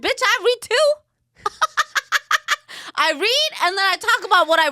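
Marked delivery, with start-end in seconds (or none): 0.0-3.7 high, loud indignant snap; indignant retort. Retold or imitated. Mom snapping back at her son over reading, high and loud. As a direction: fire back, offended. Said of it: "I'm like".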